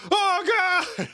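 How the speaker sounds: high, hammed-up cries for mercy; mock terrified screaming. Man imitated begging the bat, high mock scream. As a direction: squeal like a man being mauled. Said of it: "the man being like".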